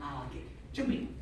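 ordinary talk

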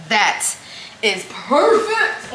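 stretched-out perfect; delighted. Real, not acted. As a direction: savor the verdict. Tastes the sauce.

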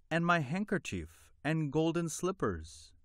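put-on high, eager little girl's question; Fatima calling out. A narrator's reading. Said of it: "called out".

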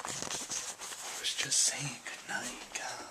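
late-night hushed whisper. Real, not acted. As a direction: whisper so nobody wakes.

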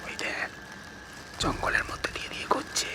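hushed breathy whisper; out of breath whisper. Real, not acted. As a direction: whisper so nothing hears you. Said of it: "আস্তে, আস্তে".

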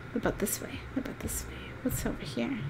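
hushed, creeping whisper; quietly exploring, barely voiced; sneaky whisper. Real, not acted.

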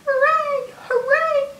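very high little cheer; high-pitched pretend celebration; playful cheer. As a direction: cheer in a high, squeaky lift. Cheering in alphabet rhyme.

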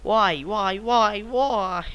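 high, loud repeated wail; exasperated lament. Real, not acted. Gamer lamenting their ignored niceness, exasperated wailing.

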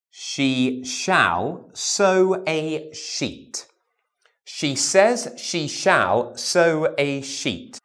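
very slow, every "sh" drawn out; slow sh drill. Shown as a demonstration. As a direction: stretch "She says she shall sew a sheet" slowly. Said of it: "let's have a go at the sh".

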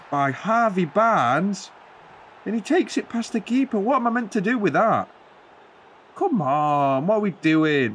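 mock voice breaking in rising disbelief, mostly high; deliberate voice cracks. The fan groans at the opponent's goal, cracking his voice on purpose. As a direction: crack the voice on purpose while despairing. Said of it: "my voice keeps breaking, but I'm doing it on purpose".

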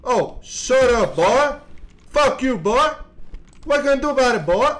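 loud, high mocking yell at the enemies; scornful shouted trash talk; taunting yell. Real, not acted. Yelling at game enemies.

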